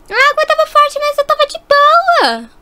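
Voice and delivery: high-pitched, carefree insistence; breezy protest. Acted. Rescued girl telling rescuer she was fine, breezy protest. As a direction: brush off the worry in a high voice. Sister brushes off rescuer's worry.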